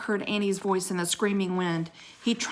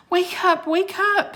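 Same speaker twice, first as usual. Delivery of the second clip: urgent; 12 semitones higher, 4 dB louder than usual. Acted. Jack waking Annie.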